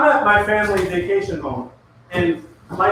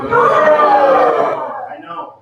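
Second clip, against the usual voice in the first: laughing; 8 semitones higher, 7 dB louder than usual. Real, not acted.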